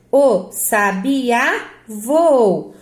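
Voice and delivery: very slow "sabiá", ending on strong Á; demonstrated pronunciation. Shown as a demonstration. Teacher reading the example for pupils, slow and drawn out. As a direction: stretch "sabiá" as sa-bi-Á, then a long "voou". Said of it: "agora eu separo assim".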